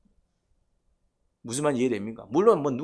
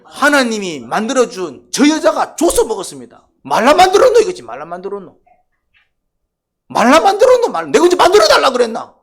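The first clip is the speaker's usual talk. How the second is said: much higher and louder, shouting back; indignant retort; 9 semitones higher, 11 dB louder than usual. Retold or imitated. Adam shouting back at God, indignant whining. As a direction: throw the blame back at God.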